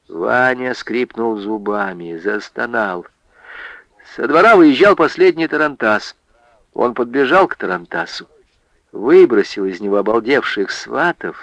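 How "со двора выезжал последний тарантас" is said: plain reading voice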